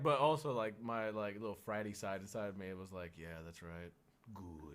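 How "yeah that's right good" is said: smug hush. Retold or imitated. Inner frat voice.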